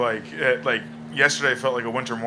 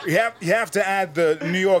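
higher, halting New York cadence; Christopher Walken; 4 semitones higher than usual. Shown as a demonstration. Guest teaching a Walken impression, speaking in it. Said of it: "you do this great walking".

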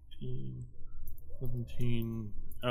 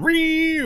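imitated sword screech; 7 dB louder than usual. Sword noise effect.

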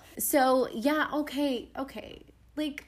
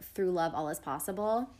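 tender quote; 6 semitones lower, 5 dB quieter than usual. Retold or imitated. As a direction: read it gently, like a promise. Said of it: "which really reminded me of".

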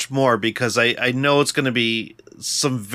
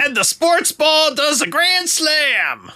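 much higher, slow, pompous sportscaster; mock sports announcer; 13 semitones higher, slower than usual. Joking along as the sports radio host, slow and pompous. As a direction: ham up a clueless sports host.

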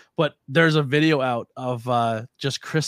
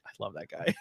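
lower, much softer aside; soft admiring; 5 semitones lower, 10 dB quieter than usual. Retold or imitated. Host admiring Face after the headbutt, said low and soft. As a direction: murmur it fondly. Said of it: "I was like".

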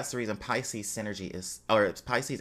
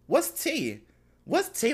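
high surprised; 14 semitones higher than usual. Real, not acted. Surprised gossip reaction.

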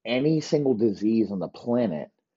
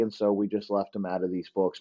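ordinary delivery

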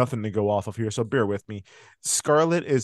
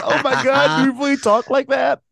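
much higher, louder mock disbelief; astonished imitation; 13 semitones higher, 7 dB louder than usual. Guy in the video hearing his own accent, astonished. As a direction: retell it as an incredulous outburst. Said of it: "He's like".

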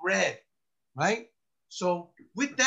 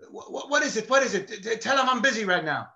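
mock lieutenant's stammering outburst, higher and louder; flustered, irritated; 6 semitones higher, 5 dB louder than usual. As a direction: act out a harried boss interrupted. Irritated lieutenant interrupted by a call.

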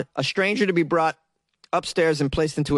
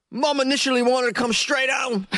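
higher-pitched put-on British accent, quoting the son; 7 semitones higher than usual. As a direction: read the son's quote in a mock British accent. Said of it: "sore throat in certain sections of England".

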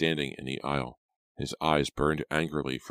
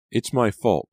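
high, louder self-blame; acted upset admission; distressed confession; 7 semitones higher, 6 dB louder than usual. A narrator's reading. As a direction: blame yourself, voice pitched up. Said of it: "Pam murmured".

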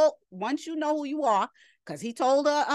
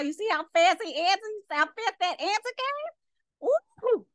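amazed, voice rising higher and higher; marvelling; 7 semitones higher than usual. Real, not acted. Preacher marvelling at the fast answer, excited. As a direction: pitch climbing to a high squeal.

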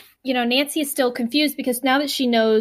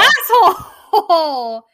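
much higher, louder, slowly stretched and laughing; laughing insult; 8 semitones higher, 6 dB louder, much slower than usual. Real, not acted. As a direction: drag the insult out through laughter.

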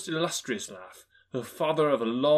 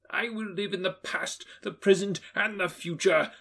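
Scrooge repeating his vow; 7 semitones higher than usual. A narrator's reading. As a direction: repeat it to himself in wonder. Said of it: "Scrooge repeated".

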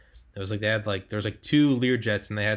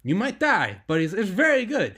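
much higher, put-on Israeli accent, self-admittedly bad; a clumsy Israeli accent, raised pitch and louder by the end; attempted Israeli accent; 13 semitones higher, 5 dB louder than usual. Quoting an Israeli ex-soldier on contractor pay, in a mock Israeli accent. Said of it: "I can't do an Israeli accent".

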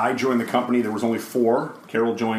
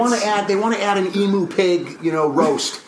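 mock client request; 11 semitones higher, 5 dB louder than usual. Mimicking clients' event requests.

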